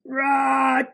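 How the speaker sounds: loud sneeze shouted out; big sneeze. A narrator's reading. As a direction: blast out a huge bear sneeze. Bear sneezes in story.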